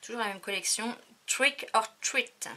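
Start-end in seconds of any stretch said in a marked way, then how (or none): 1.2-2.5 slow, higher, louder with a hammed-up English accent; accent anglais exagéré pour rire; mock English accent. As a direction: put on a playful English accent for the title. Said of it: "Avec un super accent".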